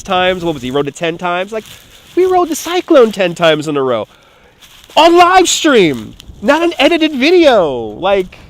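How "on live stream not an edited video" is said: high, drawn-out emphatic protest; emphatic indignation. Real, not acted. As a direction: raise the pitch and stretch the boast. Indignant boast against edited vlogs.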